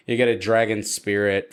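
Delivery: ordinary talk, nothing marked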